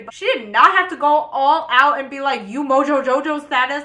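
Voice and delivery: loud, high-pitched indignation; indignant outburst. Real, not acted. Storyteller protesting a playmate's hairy insult, loud and indignant.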